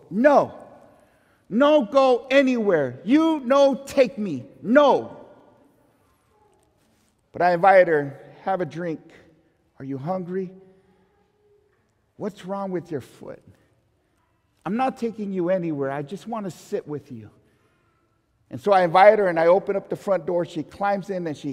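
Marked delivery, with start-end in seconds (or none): 0.1-5.2 slow, stubborn broken English refusal; a touch of an old immigrant woman's broken English. Retold or imitated. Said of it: "And she goes". 8.4-17.3 soft, gentle coaxing of a frightened stranger. Retold or imitated. Coaxing the frozen woman gently.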